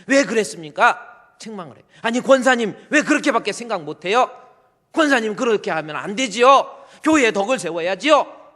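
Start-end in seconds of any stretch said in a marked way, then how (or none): 0.0-1.4 high, loud mock rebuke; a pastor scolding his deacon, loud and high; rebuking pastor. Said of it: "책망을 하는 겁니다". 2.0-8.5 high, loud scolding of a senior deaconess; pastor's rebuke. Acted. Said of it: "하고 목사님이 책망을 해요".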